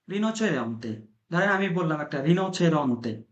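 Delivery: rinoceronte said with careful Italian sounds; Italian pronunciation demo. Shown as a demonstration. Teacher to Bengali learners, showing how rinoceronte sounds. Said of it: "একবার উচ্চারণ করেন তো".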